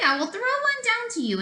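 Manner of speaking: high, quick little pig; cheeky little pig. Acted. Little pig offers the wolf an apple, high and quick. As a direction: chirp it out brightly.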